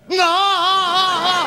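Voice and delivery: high, long-held wail; hammed-up drawn-out cry, pitched high; mocked photo reaction. Imitates face in the photo.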